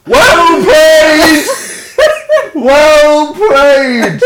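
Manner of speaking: high, loud, helpless laughing cheer; laughing shout. Real, not acted. Co-host conceding the word argument, shrieking with laughter. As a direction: shout congratulations through the laughter. Laughing after being caught out.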